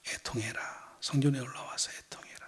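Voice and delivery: Jesus's words said softly, close to a hush; hushed command. Acted. Jesus urging the listeners to mourn, hushed.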